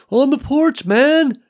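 high, loud excited call; excited buddy. Acted. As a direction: blurt it out excitedly. Walt excited on radio.

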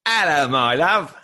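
loud, drawn-out British greeting; mock British accent. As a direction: put on a big, high, hammed-up British voice. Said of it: "you started speaking British".

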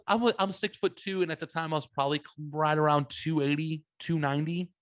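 ordinary delivery

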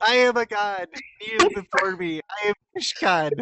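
grandiose fish god voice; megalomaniac rant. Pretend. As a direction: proclaim it like a deluded god. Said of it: "You're a loon".

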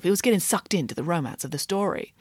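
plain reading voice

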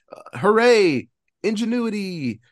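mock cheer. Recapping the movie to a friend, mock cheer. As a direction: cheer it like a fake fan.

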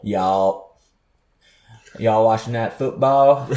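southern drawl on y'all; southern accent. Shown as a demonstration. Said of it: "we say y'all".

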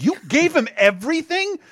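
high-pitched mock disbelief; incredulous outburst. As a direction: retell it as a shocked, high-voiced question. Retelling actor's shock at assistant.

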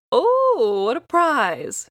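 loud, high delighted cry; old woman exclaiming. A narrator's reading. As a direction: burst out with greedy delight. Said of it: "she exclaimed".

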